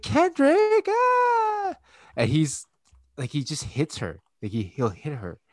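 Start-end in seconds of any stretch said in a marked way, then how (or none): 0.0-1.7 put-on very high, loud child's squeal; imitating daughter's wail. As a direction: shriek like a little kid being hit. Voices daughter being hit by brother.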